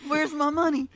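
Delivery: put-on cartoon voice demanding payment; Stewie Griffin. Friend quotes the cartoon at the host, demanding impression. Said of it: "that Stewie Griffin".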